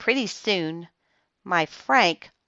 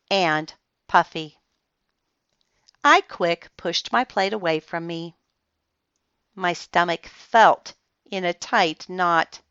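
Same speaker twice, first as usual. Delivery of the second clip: ordinary delivery, nothing marked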